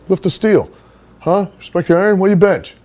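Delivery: cocky wrestler dude-bro greeting, high and loud; Johnny's bro voice. Retold or imitated. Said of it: "that's how he would talk".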